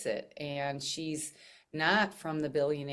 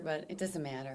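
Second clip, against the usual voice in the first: usual speaking voice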